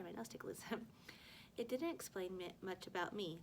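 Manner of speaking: plain reading voice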